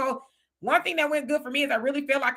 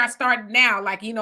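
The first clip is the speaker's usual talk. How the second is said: ordinary talk, nothing marked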